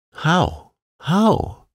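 high, urgent repeated question; eager cry. A narrator's reading. Girl crying out eagerly to the gardener, excited. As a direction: burst out, forgetting to be careful. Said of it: "cried Mary".

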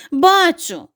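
high, loud shout; mother duck shouting to the dog. A narrator's reading. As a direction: call out that you see her. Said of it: "закричала мама качка".

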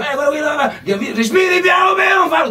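high, hammed-up shout; put-on frantic rock star cry; mock rocker yelling. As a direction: climb to a loud, high yell. Acting out the rocker among fans.

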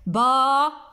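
باخرة split into evenly spaced syllables; long held با, then خي، را، تن apart; clapped syllables. Shown as a demonstration. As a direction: beat out باخرة one syllable at a time, long pauses between. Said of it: "با بالتصفيق".

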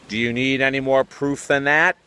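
ordinary talk, nothing marked

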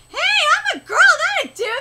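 high, loud indignant retort; indignant protest. Real, not acted. Girl correcting someone who called her dude, indignant.

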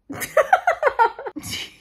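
loud, high peal of laughter; big laugh. Real, not acted. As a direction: crack up loudly at what you read. Cracks up at the book.

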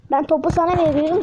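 normal talking voice

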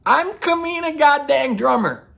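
loud, very high defiant proclamation; hammed-up outrage. Drummer demanding respect from her wife, hammed-up outrage. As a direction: declare the name in mock fury. Acted climax of Drummer's angry rant.

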